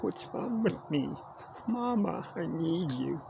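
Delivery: playful fake sob; mock crying. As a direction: pretend to break down and call for mama. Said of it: "I'm just kidding".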